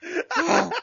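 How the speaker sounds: breaking up with real giggles; helpless laughter. Real, not acted. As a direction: confess while cracking up. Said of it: "I'm laughing here".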